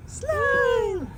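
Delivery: high cheering shout; excited cheer. Real, not acted. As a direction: cheer her down the slide. Cheering kid down slide. (masayang sigaw)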